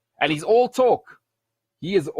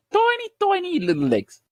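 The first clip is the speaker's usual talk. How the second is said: high, squeaky mock on "Tiny, tiny little legs"; 14 semitones higher than usual. Mocking Wilder's small legs to the podcast host in a high squeak. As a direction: squeak it like something tiny and silly.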